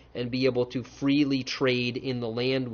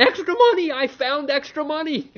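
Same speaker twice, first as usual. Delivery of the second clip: much higher, giddy with delight; 11 semitones higher, 4 dB louder than usual. Retold or imitated. A lucky man bragging about extra money, delighted. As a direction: gleefully show off the windfall. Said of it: "You go".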